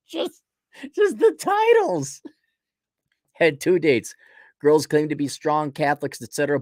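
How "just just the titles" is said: high, slow sarcastic drawl; high, slow ridicule of the titles; sarcastic mock. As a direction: stretch it out high in mock disbelief. Mocking the dating site names.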